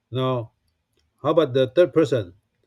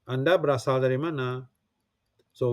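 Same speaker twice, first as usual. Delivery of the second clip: ordinary talk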